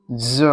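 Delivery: ज said as a buzzing z with a short vowel; z sound. Shown as a demonstration. Teacher showing the class what to pronounce, ज as buzzing z. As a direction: voice the ज as z, not j.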